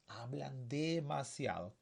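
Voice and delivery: slow, stretched-out "demasiado"; drawn-out repetition. Shown as a demonstration. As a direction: draw out "demasiado" to point it out. Teacher repeats to point out adverb.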